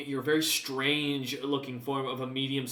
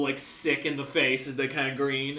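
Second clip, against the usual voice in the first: ordinary talk, nothing marked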